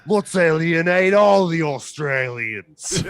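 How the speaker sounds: put-on loud, high, drawn-out Australian accent; mock Aussie accent. Podcast host gleefully offending a whole country, in mock Aussie accent. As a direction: belt it out in exaggerated Aussie. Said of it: "Let's all do our best Aussie accent".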